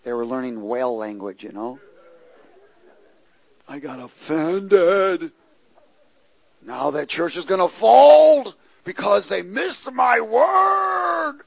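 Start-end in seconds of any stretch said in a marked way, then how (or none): 3.7-11.4 mock-indignant complaint rising into a yell on word; indignant churchgoer. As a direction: ham up the wounded prophet, building to a shout.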